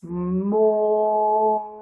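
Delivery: mo drawn out for careful listening; sounding out a syllable. Shown as a demonstration. Teacher repeats mo slowly after telling pupils to listen well. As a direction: stretch mo for the class to hear. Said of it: "Écoutez bien".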